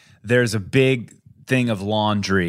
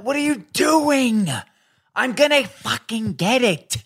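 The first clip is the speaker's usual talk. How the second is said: exasperated yell; 12 semitones higher than usual. Retold or imitated. Husband yelling at wife hauling laundry, exasperated shout. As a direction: throw up your hands and shout at her.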